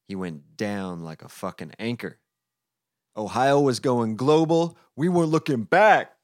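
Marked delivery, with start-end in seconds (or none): 3.2-6.1 big loud high cheer; hyped shout. Acted. Friends fleeing down the mountain, shouting triumphantly. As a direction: belt it out like a triumphant chant.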